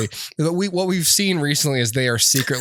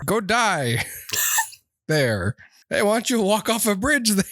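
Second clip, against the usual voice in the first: mock Canadian; 8 semitones higher than usual.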